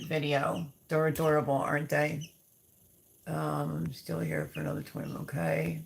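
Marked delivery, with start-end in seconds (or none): none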